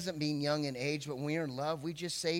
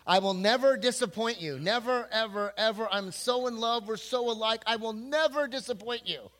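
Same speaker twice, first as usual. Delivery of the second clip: higher, louder lovestruck promises; gushing vows; 6 semitones higher, 5 dB louder than usual. Acted. Starry-eyed groom to his bride, higher and louder gushing.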